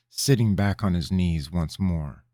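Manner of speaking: usual speaking voice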